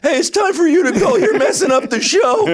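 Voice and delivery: put-on high, squeaky kid voice; mock a young teen trying to be tough; loud, piping adolescent order; fourteen-year-old bouncers. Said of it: "they got two fourteen-year-old guys".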